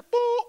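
loud, high held "boo"; imitated tone. Preacher retelling a waiting-room video, imitated loud tone. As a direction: sound a sudden loud beep-like boo.

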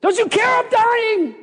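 high, frantic mock shout; panicked yelling. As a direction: yell a desperate accusation, freaking out. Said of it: "yelling at Jesus".

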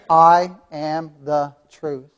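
I am the truth, every word slow and separate; slow quote. Shown as a demonstration. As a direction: space out each single syllable. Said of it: "All one-syllable words".

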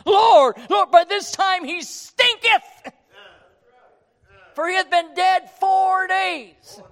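high, loud, pleading protest; protesting Martha. Acted. Preacher voicing Martha protesting to Jesus, loud and high. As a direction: cry out in alarm against opening the tomb. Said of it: "she's protesting".

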